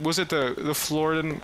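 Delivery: normal talking voice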